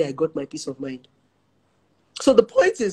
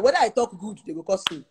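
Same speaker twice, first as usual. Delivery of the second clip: ordinary talk, nothing marked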